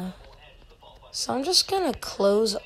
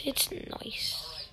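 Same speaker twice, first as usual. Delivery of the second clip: whispering; 5 semitones lower, 14 dB quieter than usual. Real, not acted. Aside as saboteur.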